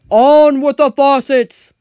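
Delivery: loud, high, drawn-out holler; loud far-off shout. A narrator's reading. Cloud voice shouting down to the travelers, loud and clear. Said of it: "very loud and clear".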